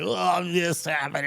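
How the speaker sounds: imitated woozy oversharing; not talking normally. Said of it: "It's not like I'm talking to y'all. It's more like".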